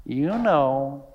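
soft, knowing parrot voice; parrot punchline. Acted. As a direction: deliver "You know" as a sly deadpan parrot. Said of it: "the parrot says".

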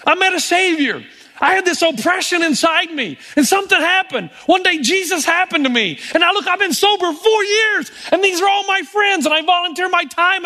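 lightly voiced man pouring out his story; a hint of an excited convert; joyful testimony. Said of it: "What he really meant is".

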